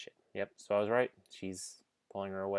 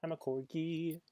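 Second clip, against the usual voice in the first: higher, louder, silly pet voice; voicing the dog; 9 semitones higher, 5 dB louder than usual. Streamer puts words in the corgi's mouth, cutesy voice.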